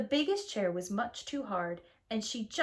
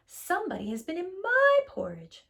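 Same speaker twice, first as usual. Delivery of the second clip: Mama Bear; 9 semitones higher, 5 dB louder than usual. Acted. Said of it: "said Mama Bear".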